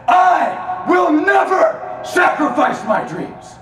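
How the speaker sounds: loud, slow, high declaration; shouted vow. Shown as a demonstration. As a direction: roar the vow from the gut. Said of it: "I snapped inside".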